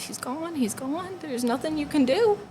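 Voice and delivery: high, grieving retold cry, held back in volume; anguished mother. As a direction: voice a heartbroken mom, pitched high. Bereaved mother's words, retold.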